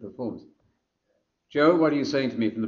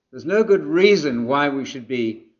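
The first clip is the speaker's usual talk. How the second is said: ordinary talk, nothing marked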